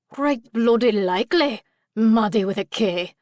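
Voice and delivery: cheeky Scottish pixie; mock them in a Scottish accent. A narrator's reading. Pixie scoffing at the intruder, Scottish accent. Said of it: "sounded like a Scottish accent".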